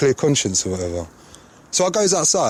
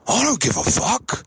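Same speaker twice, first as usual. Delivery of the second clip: high, drawn-out mock aggression; squaring up, imitated; 8 semitones higher than usual. Old man right up in his face, imitated slow defiance. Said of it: "like, he squared up to me".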